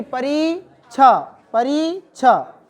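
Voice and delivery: परीक्षा drawn out syllable by syllable; slow dictation. Shown as a demonstration. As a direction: say परीक्षा slowly so students can write it. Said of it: "बड़ी की मात्रा रहेगी". (धीरे-धीरे लिखवाना)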